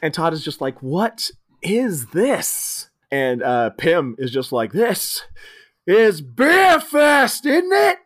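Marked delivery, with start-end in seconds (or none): 0.8-2.9 slow, drawn-out baffled question; bewildered. Retold or imitated. Todd bewildered. 4.7-8.0 high, loud, slow British boast; hammed-up British.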